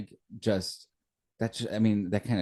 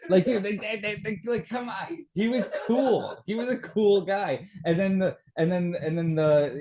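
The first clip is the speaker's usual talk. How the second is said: excited; 6 semitones higher than usual. Real, not acted. Excited defense of favorite character.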